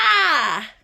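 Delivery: big open-throated shout; a real loud playful yell. Shown as a demonstration. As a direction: let out a full shout for the child. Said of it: "We're gonna shout on three".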